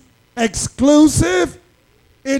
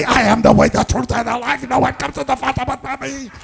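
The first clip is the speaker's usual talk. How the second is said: mocking quote; much faster than usual. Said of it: "How on earth can you say".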